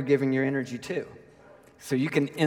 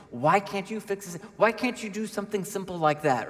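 higher-pitched mock scolding; pleading, fed-up self-reproach; exasperated self-scolding; 5 semitones higher than usual. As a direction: berate yourself in frustration. Acts out berating himself.

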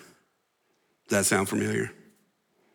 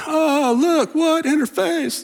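much higher, sneering taunt; mock her like a cruel teen filming her; hammed-up mocking sneer; mocking jeer; 4 dB louder, slower than usual. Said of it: "making TikTok videos of her, like, going".

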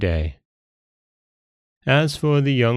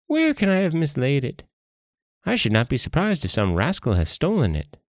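higher-pitched, feigned bewilderment; puzzled-acting monkey; 7 semitones higher than usual. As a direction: play innocent as a wily monkey.

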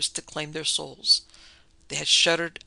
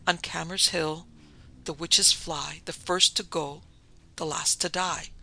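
usual speaking voice

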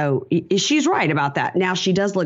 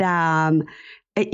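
ordinary talk, nothing marked

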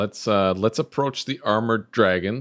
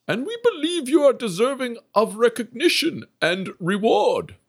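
much higher, squeaky solemn praise; high mock dragon council; 11 semitones higher than usual. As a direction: play the council in a high cartoon voice. The council addresses the group.

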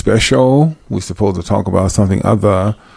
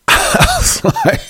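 laughing; 3 dB quieter, slower than usual. Real, not acted. Laughing at the impression.

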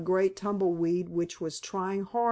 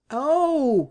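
a high, louder "Oh!" that falls away in letdown; disappointed oh; 6 semitones higher, 7 dB louder than usual. A narrator's reading. Boy learns it is only tumbleweed, disappointed falling oh. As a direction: let the hope drop out of it. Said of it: "with a falling infection of disappointment in his voice".

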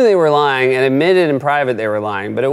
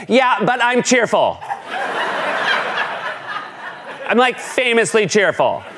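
put-on giggly teen; 5 semitones higher, 3 dB quieter than usual. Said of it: "like a gossipy teen".

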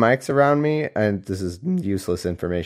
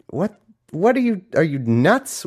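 high-pitched, incredulous homeowner; acted bafflement; 7 semitones higher than usual. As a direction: react as if the visitor is crazy.

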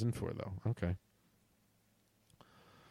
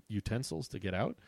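ordinary talk, nothing marked

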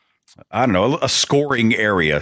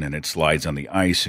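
ordinary talk, nothing marked

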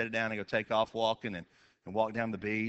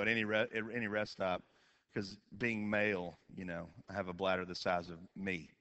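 normal talking voice

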